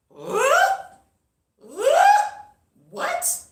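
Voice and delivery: high shrieked "What?"; reliving the moment he named another girl, shrieking; shocked disbelief. Retold or imitated. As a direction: screech it in total disbelief. Shocked reaction to his news.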